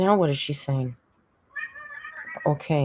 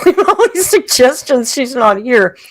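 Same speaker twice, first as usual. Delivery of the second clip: talking through a real laugh; real laughter through a loud line; 8 semitones higher, 8 dB louder than usual. Real, not acted. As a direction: laugh out the words, louder.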